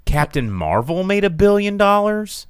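high disbelieving question; can't believe it; incredulous. Real, not acted. Shocked list of billion-dollar movies.

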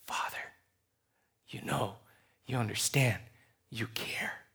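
very soft, low, intimate prayer; hushed prayer. Acted. Believer coming to God as Father, hushed prayer. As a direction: sink almost to a whisper, pausing between phrases.

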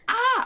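high, loud panicked shriek; short high scream; panicked squeal. Retold or imitated. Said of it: "I was just like".

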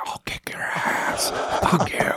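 slow, menacing stage whisper; whisper yelling. Retold or imitated. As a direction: threaten in a hushed hiss. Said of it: "the other guy's like".

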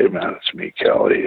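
put-on mildly choked, reaching out to an old friend. To his injured friend in hospital, a touch choked up. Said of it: "I choked up a little bit and I'd say".

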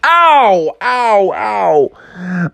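high "ow" stepping down in pitch; pained yelps. Acted. Shark chopped on the nose, pained yelps.

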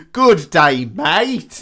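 loud, high, hammed-up Australian greeting; Australian accent. Mock director showing off an Australian accent, loud and hammed-up. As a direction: belt it out in a broad Australian accent. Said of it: "You can't do an Australian accent".

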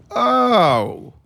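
drawn-out high "oh" of realization; mock realization. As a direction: let it dawn on you, high and loud. Acting out a sudden realization.

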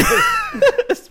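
high burst of laughter; loud laugh. Real, not acted. Reacting to a joke about long episodes.

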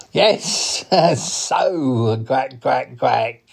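cartoon duck voice with a laugh; chuckle, then quack along; talking duck character; chuckling duck. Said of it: "he is a duck".

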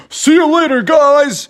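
put-on high, eager kid voice; Bubby waving before he jumps; Bubby the bear. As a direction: call it out excitedly. Bubby jumping off slide.

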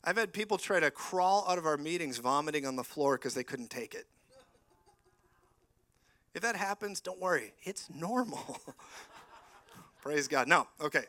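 ordinary delivery

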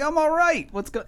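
high, bright-pitched sing-song greeting; cheery all right greeting. Retold or imitated. Teasing Dave by repeating his greeting, high and sing-song. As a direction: pitch it way up like a chirpy hello. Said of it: "I'm like".

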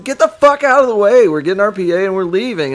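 a loud, high mock yell to clear the way; retelling how he yelled at the crowd, loud and high; retold shout. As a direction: bark it at the crowd blocking the van, half hammed-up. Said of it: "I said".

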